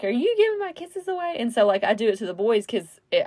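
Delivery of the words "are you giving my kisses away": put-on high, teasing singsong to a little girl; lightly voiced stepdad teasing. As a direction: playfully scold her for giving kisses away.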